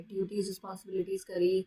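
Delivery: ordinary delivery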